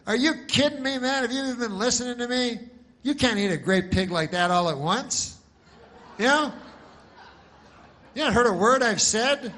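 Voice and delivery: loud, high-pitched indignant outburst; exasperated farmer. Acted. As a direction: scold the listener in disbelief.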